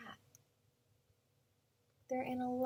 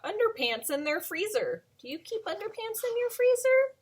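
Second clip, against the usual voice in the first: plain reading voice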